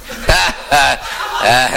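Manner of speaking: mock laugh brushing the comic off; imitating a smug, forced laugh; dismissive fake laugh. Censor laughs off the comic's complaint, mock laughter. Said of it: "That's the first defense with me".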